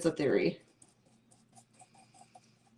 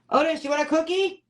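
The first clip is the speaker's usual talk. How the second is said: high, loud sing-song call to a dog; cheery high dog-calling voice; 10 semitones higher, 5 dB louder than usual. Real, not acted. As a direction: coax the dog with a cookie, pitched up high.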